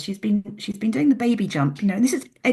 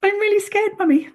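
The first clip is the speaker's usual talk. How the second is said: put-on high, louder frightened child's plea; scared little girl; 12 semitones higher, 5 dB louder than usual. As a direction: voice the six-year-old scared at the top. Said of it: "she sits there going".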